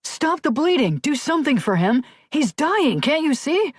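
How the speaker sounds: high, desperate cries; panicked pleading. A narrator's reading. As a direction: beg them to save him. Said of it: "Cunningham cried".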